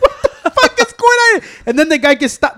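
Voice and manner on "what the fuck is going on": high, loud burst of disbelief; stunned outburst. Retold or imitated. Retelling his shocked reaction to fighters brawling, loud high outburst. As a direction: shout it like you can't believe what you're seeing. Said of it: "I was like".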